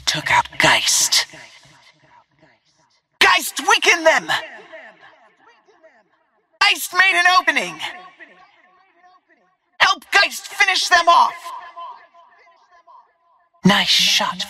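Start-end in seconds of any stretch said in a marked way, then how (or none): 3.2-4.3 high-pitched combat yell; urgent battle shout. Acted. Urgent battle call to teammates. 6.6-7.8 high, fast yelled callout; quick, high-pitched battlefield cry; urgent battle shout. Acted. Urgent battle call to teammates. 9.8-11.3 high-pitched combat yell; urgent battle shout. Acted. Urgent battle call to teammates.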